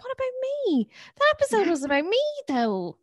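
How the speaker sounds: high, squeaky mock protest; playfully wounded, voice jumping up; mock indignant whine. Cohost griping that listeners praised Emma, high whine.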